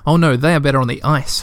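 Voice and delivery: ordinary delivery, nothing marked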